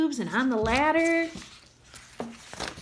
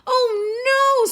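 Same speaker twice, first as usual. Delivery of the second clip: high, louder wail of dismay; groaning dismay; 12 semitones higher, 5 dB louder than usual. A narrator's reading. Sophie groaning in dismay, high and loud. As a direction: let it out as a pained groan. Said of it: "Sophie groaned".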